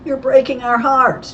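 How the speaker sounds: high, loud, cheerful teasing; mock-sympathy delivered cheerfully. A narrator's reading. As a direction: tease them cheerfully, voice right up. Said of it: "George said cheerfully".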